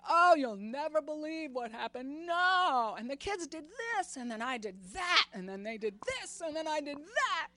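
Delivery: loud, high, breathless rush of news; excited chatter. Retold or imitated. Daughter gushing her chaotic day to her mother, loud and excited. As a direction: gush the day's chaos to your mom.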